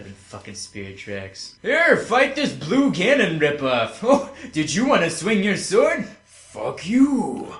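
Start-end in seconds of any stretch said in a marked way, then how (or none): 1.6-7.5 high, loud, drawn-out mock game voice; mocking rant. Ranting to co-hosts about a bad game, mocking. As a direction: jeer at the game in a raised, exaggerated voice.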